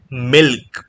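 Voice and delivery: ordinary delivery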